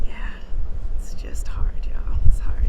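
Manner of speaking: breathy whisper, close to crying; holding back tears. Real, not acted. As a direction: fight the tears as you say it. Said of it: "I'm trying not to cry right now".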